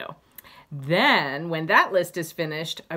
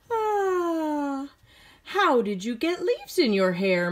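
high, lightly voiced mother with a yawn; a hint of a surprised mother; mother's voice; 8 semitones higher, slower than usual.

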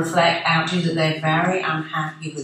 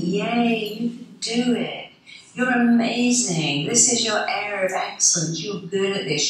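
higher, beaming cheerleader praise; 5 semitones higher than usual. Retold or imitated. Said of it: "change that voice to a cheerleader".

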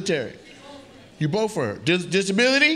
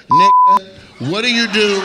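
sudden shout; 6 semitones higher, 9 dB louder, slower than usual. Real, not acted. Sudden outburst at guest.

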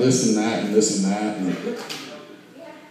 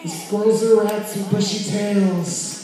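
much higher, slow disgusted "ew"; grossed-out kid; 9 semitones higher, much slower than usual. Retold or imitated. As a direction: squeal in childish disgust. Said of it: "I was like".

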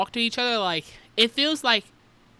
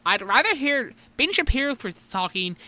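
rapid-fire high-pitched know-it-all talk; Ben Shapiro impression; 4 semitones higher, 4 dB louder than usual. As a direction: rattle it off higher and louder, showing off. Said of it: "it sounded pretty on tune with Dave Shapiro, or Ben Shapiro".

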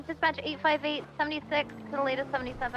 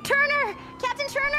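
calling out; 14 semitones higher, 5 dB louder than usual. Pretend. Calling out loudly.

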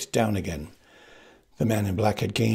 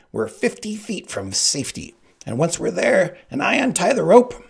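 high voice climbing higher and louder; gloating; 9 semitones higher, 5 dB louder than usual. A narrator's reading. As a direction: build with glee toward the threat. Sicilian gloats about untying rope.

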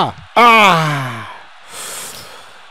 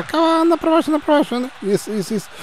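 much higher pleading calls; urgent calling; much faster than usual. Real, not acted. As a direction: urge him down the wing. Urging a player. (apelo agudo)

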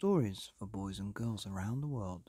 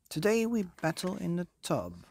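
louder, higher king's proclamation; booming king; 9 semitones higher, 5 dB louder than usual. Acted. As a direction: announce it grandly as the king. King answers in his voice.

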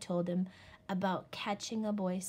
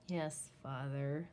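lower, softer, slow mutter; 5 semitones lower, 5 dB quieter than usual. Acted. Little wolf giving in to father, soft low mutter. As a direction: grumble it under your breath. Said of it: "Little Wolf muttered".

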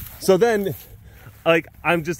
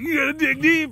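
rallying coach; 10 semitones higher than usual. Retold or imitated. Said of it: "the coach is rallying".

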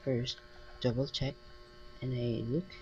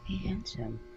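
flirty; 6 semitones higher than usual. A narrator's reading. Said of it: "with a flirty smile".

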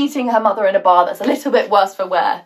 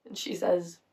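usual speaking voice

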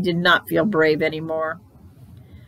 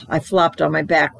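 ordinary delivery, nothing marked

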